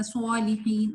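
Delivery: صالحین said as "swalihin"; wrong rounded reading. Shown as a demonstration. Teacher showing the mistake people make, rounded "swa" in صالحین. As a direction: round the lips on the ص of صالحین, adding a w. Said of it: "غلط".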